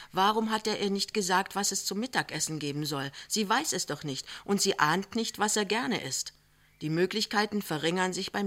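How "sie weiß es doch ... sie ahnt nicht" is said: usual speaking voice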